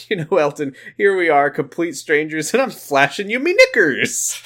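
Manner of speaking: high, hammed-up flirty joking voice; Jackie Tyler. Jackie joking with Elton over laundry, high imitated voice. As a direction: build to a loud high punchline. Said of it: "turns back to him ... and goes".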